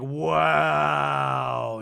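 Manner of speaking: one long, held-out "wow"; mock drunken wow. Drunk Danny answers Jason, mock drawn-out wow. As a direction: stretch it out, imitating a drunk man.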